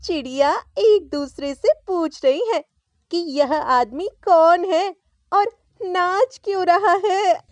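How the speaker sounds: wife joking about the dancing husband, amused; amused mother. A narrator's reading. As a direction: tease him with a grin in the voice. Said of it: "हंसकर बोली".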